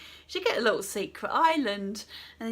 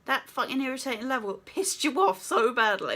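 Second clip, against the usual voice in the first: usual speaking voice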